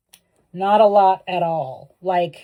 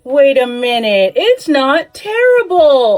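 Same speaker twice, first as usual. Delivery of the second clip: excited; 11 semitones higher, 8 dB louder, slower than usual. Real, not acted. Hair piece turns out cute.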